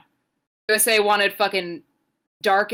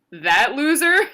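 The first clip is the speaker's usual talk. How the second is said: louder, higher incredulous question; imitating friend's dad; 5 semitones higher, 5 dB louder, slower than usual. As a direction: quote a dismissive dad, pitched up. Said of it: "I asked him, and he said".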